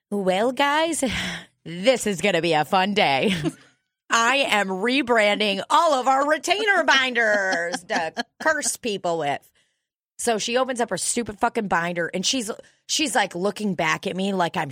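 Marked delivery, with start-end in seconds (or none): 0.1-9.5 hammed-up gleeful announcement, pitched up and drawn out; gasp, then proudly unveil it to the office; mock-perky office announcer voice; overexcited receptionist. Imagines receptionist unveiling her binder.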